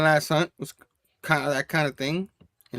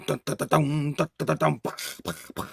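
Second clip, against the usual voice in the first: mock movie music; 6 dB quieter than usual. Imitating dramatic movie music.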